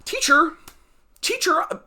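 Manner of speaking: high, whiny call for the teacher; mock a tattling kid raising a hand; imitating a player tattling to the DM, whiny; whiny student. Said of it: "where it's like".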